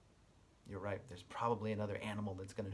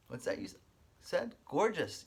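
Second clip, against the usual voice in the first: ordinary delivery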